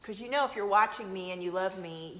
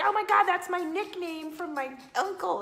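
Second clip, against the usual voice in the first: high, loud mock-amazed outburst; amazed client; 10 semitones higher, 6 dB louder than usual. Reader imitates a client recognising a nickname, amazed. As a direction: exclaim it like someone astonished by a lucky guess. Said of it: "the person will be like".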